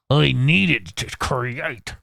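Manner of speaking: very slow, hammed-up put-on voice; exaggerated drawn-out character repeat. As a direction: stretch every word theatrically. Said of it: "you're getting my voices".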